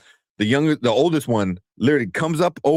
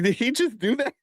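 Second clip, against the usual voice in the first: high-pitched, stunned question; acting out baffled shock; retold disbelief; 14 semitones higher than usual. Dad asking after son's prank, incredulous.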